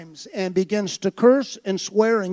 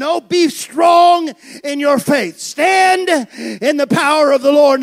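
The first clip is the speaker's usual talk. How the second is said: slow, loud, emphatic command; firm rebuttal; 4 semitones higher, 6 dB louder, slower than usual. Acted. Preacher correcting the compromisers, slow and loud. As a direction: plant your feet and declare it.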